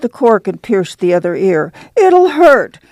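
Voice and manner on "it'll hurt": high, scared pleading; a girl dreading the needle, voice shooting up; frightened whimper. Acted. Girl afraid of ear piercing.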